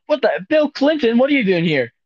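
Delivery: high-pitched, startled squeal of recognition; startled disbelief. Acted. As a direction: act amazed at a famous visitor. Startled kid meeting Clinton in skit.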